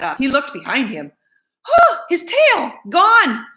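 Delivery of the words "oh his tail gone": high, slow gasp of horror; shocked dismay. A narrator's reading. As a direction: cry out at the loss. Narrator's horror at stump.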